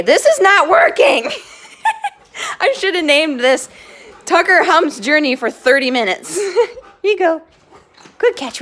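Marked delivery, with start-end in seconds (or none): 0.0-5.0 loud, laughing give-up; laughing exasperation. Real, not acted. Giving up on training the dogs, loud and laughing. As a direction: half-shout through a laugh.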